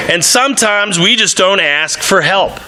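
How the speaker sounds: normal talking voice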